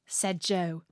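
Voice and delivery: ordinary delivery, nothing marked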